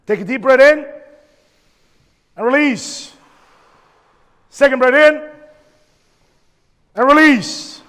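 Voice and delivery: a coach counting a crowd through three breaths; breathwork cues. Acted. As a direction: call each breath to the whole room. Leads a breathing exercise.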